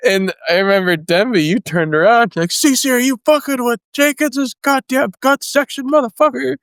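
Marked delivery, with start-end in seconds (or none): 2.5-6.6 high, shouted challenge; drill instructor yell. Retold or imitated. Denby yelling at CC over a recruit, high angry shout. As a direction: bellow it across the formation.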